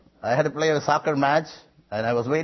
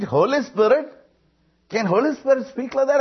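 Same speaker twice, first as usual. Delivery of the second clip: astonished question; 9 semitones higher, 3 dB louder than usual. Retold or imitated. Retold astonished question to the preacher.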